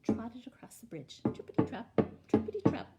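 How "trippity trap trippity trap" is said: punchy loud hoof rhythm; loud trippity trap. Acted. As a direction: clatter the hoofbeats out. Hoof sound effect.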